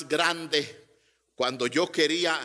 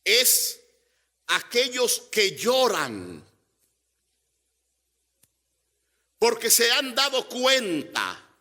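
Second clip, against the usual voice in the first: rising, insistent sermon voice, higher and punching each no es que; preaching; 9 semitones higher, 5 dB louder than usual. Acted. Preacher presses the congregation about why they cry, forceful. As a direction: drive the point home to the congregation.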